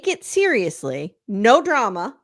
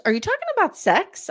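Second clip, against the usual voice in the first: high, quicker incredulous question; imitating Robin; 10 semitones higher, faster than usual. Host mimicking a wife asking about sex, high-pitched incredulity. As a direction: pitch it way up, mock-scandalized. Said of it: "And Robin goes".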